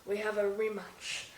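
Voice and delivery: hushed, low nature documentary narrator; nature documentary guy. Pretend. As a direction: narrate softly, like watching wildlife unseen. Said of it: "sounding like a nature documentary guy".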